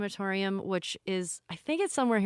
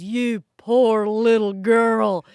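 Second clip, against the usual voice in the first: slow, much louder mock pity from a huge man; pitying big guy; 2 semitones higher, 10 dB louder, slower than usual. Huge crematorium worker pities the sobbing owner, slow and loud. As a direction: boom it out with big sympathy.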